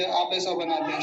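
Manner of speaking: ordinary talk, nothing marked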